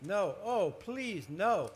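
acted desperate "no, please"; panicked pleading. A narrator's reading. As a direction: plead in rising dread. Narrator acts out panicked pleading.

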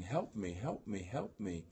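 ordinary delivery

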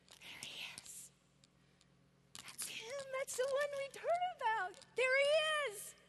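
half-whispered awe building to excitement; awed whisper. Acted. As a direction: start hushed, then let the wonder break out. Crowd leaning in to see Jesus.

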